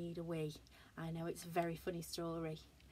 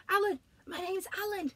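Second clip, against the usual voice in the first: bear voice; 12 semitones higher, 7 dB louder than usual. Acted. Bear answering announcer.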